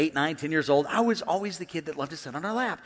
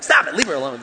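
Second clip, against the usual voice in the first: mock sharp telling-off, suddenly louder; retold scolding; 6 dB louder than usual. Wife telling husband to leave a woman alone, mock scolding. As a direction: imitate a wife cutting in to stop him.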